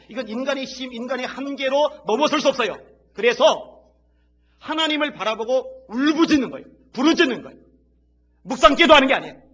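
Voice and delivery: loud, high impassioned preaching; shouting preacher building to a peak; fiery preaching. Real, not acted. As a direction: drive it home at full force. Preaching rising to full force.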